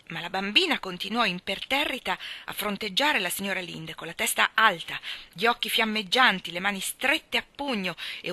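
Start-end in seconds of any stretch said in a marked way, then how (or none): none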